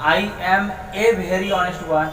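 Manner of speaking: put-on every word of I am a boy read very slowly; teacher reading the English example slowly; slow model reading. Shown as a demonstration. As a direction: read it out slowly for the class to copy. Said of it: "तो very लग गया".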